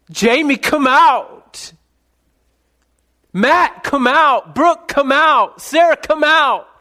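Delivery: high, loud, drawn-out shepherd's call; calling out by name. Acted. Shepherd summoning his sheep one by one, loud high calls. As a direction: holler each name out to summon the flock.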